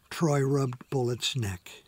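ordinary delivery

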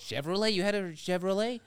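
high, loud put-on American voice; mocking Americans, amazed; mock American accent. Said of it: "my friend from America".

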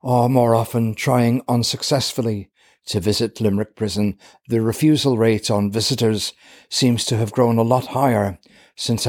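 low, measured reporter read; steady documentary voice-over; news narration. Acted.

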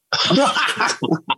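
high burst of laughter; real amused laugh. Real, not acted. Guest laughing at the question about hating Queensland, amused laugh.

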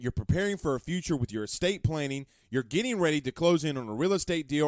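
normal talking voice

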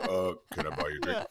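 mock-manly low pickup voice; bass in voice. Runway dancer suddenly acting butch to offer a drink, deep voice. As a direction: drop the voice low after an awkward "uh". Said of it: "try to get the bass in my voice".

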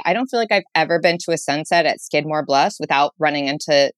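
ordinary talk, nothing marked

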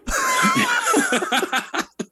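shrieking laugh. Real, not acted. Cracking himself up over the curling rout, shrieking laugh. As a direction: howl with laughter at your own joke. After joking [name] who.

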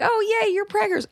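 very high, sugary mock congratulation; gushing sweet well-wisher. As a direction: imitate overly sweet, squealing excitement. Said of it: "people who are so sweet".

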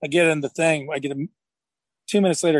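ordinary talk, nothing marked

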